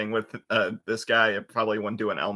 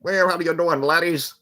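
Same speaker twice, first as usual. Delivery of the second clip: higher, put-on Scottish greeting; cheerful Scottish puppet voice; hearty Scottish hello, pitched up; Scottish accent; 7 semitones higher, 4 dB louder than usual. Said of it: "like, a Scottish accent".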